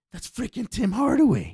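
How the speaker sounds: high, loud starstruck gasp; retold fan freaking out; starstruck exclaim. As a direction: blurt it out in awe. DJ starstruck seeing Tim Hardaway.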